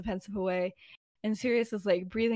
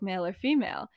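plain reading voice

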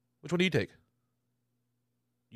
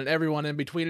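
ordinary delivery

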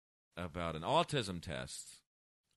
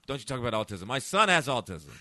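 raised, louder scolding; offended audience member; 4 semitones higher, 9 dB louder than usual. Retold or imitated. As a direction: imitate someone telling off the comic. Acts out offended woman's objection.

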